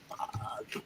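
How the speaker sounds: a breathy whispered curse under the breath. Retold or imitated. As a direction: curse to yourself so nobody hears. Quiet curse at KP duty.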